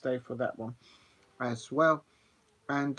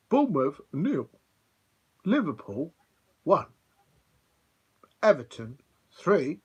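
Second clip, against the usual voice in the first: classic results reading; 3 semitones higher, 6 dB louder than usual. Retold or imitated. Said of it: "the professional commentator, back in the day would have said".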